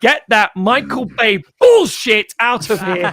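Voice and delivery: loud, high, slow laughing protest; mocking Michael Bay moment, loud and laughing; laughing outraged rant. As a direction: shout it down while laughing. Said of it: "And I'm like".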